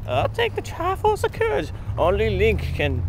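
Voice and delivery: hammed-up high old king; high, slow mock old father. Acting father character.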